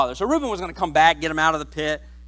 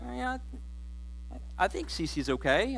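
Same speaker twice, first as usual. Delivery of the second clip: half-hearted defence; 12 dB quieter than usual. Acted. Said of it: "they'll just be like".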